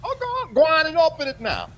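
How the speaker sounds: excited urging. Real, not acted. Urging her to open gift.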